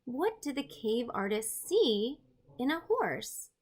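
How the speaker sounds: ordinary talk, nothing marked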